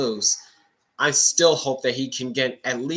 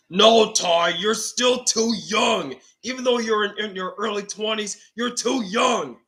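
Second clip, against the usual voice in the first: put-on a grandpa setting the boy straight; 8 semitones higher, 4 dB louder than usual. Grandpa refuses Ty.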